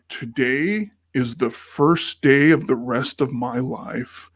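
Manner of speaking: slow, deliberate retold declaration; grand proclamation. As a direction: proclaim it word by word, savoring the dream. Proclaims his hopes on the ride.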